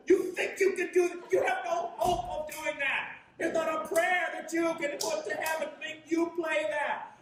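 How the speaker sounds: high, loud mock yell, laughing through it; inner scream. Trumpeter screaming inside at his unimpressed students, high laughing mock yell. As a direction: let the inner outrage burst out, laughing. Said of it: "Inside, I'm screaming".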